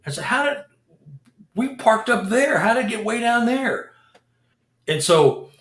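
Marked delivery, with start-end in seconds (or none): 0.2-3.9 disbelieving alarm. Retold or imitated. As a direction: sound truly baffled the car moved. Car moved, confused.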